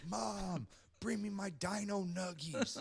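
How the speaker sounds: high, drawn-out yell for mom; mock scream. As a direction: scream like a demanding basement dweller. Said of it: "I'll just fucking scream".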